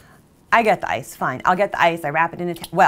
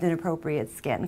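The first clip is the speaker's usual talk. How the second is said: ordinary delivery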